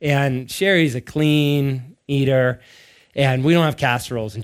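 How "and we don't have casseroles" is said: ordinary talk, nothing marked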